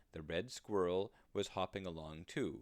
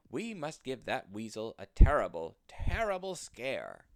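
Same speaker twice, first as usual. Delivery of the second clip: much louder, slowing hard on terrible scare; dramatic declaration; 4 semitones higher, 9 dB louder than usual. Acted. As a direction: make the plan sound thrillingly scary. Squirrel plots to scare weasel.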